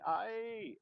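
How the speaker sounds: high mock shriek; imitated scream. Imitating the screaming crowd as the plane hits, high shriek. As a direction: squeal like a crowd in panic.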